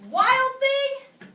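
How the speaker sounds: loud, high call of "Wild thing!"; mother calling out. A narrator's reading. Teacher voicing the mom calling to Max, shouting. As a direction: shout it across the house like a cross mother. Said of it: "His mother called out to him".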